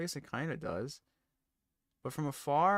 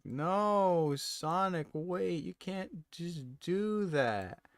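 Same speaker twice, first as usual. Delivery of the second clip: higher, drawn-out mock plea; playful pleading, voice raised; 7 semitones higher, much slower than usual. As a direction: beg Sonic to stop, half-joking. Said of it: "Going like".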